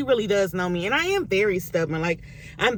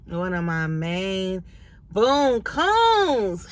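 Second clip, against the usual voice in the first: playful mock voice; much slower than usual. Comic aside naming a friend.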